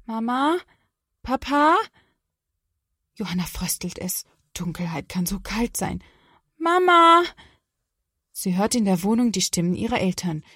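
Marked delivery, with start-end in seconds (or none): 0.0-1.8 calling out. Acted. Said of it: "Erst leise, dann immer lauter ruft sie". 6.6-7.2 high, loud frightened call for mom; a child's high, loud cry for help. Acted. Child calling parents in dark.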